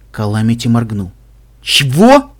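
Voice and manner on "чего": surprised. Acted. Calamity baffled by answer.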